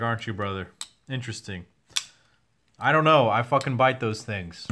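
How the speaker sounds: ordinary talk, nothing marked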